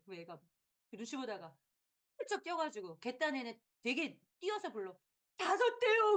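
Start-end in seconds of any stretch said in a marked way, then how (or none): 5.4-6.2 put-on high, loud kid blurting out; eager child. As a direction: shout the answer like a scared kid. 아이가 외치듯 대답. (아이가 다급하게 외치듯)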